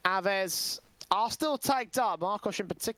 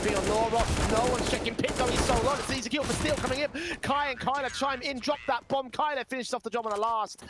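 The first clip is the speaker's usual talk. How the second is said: urgent, higher play-by-play; esports caster; 5 semitones higher than usual. Acted. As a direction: build the call to the finish. Live play-by-play round end.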